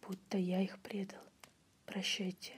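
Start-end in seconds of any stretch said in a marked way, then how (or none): none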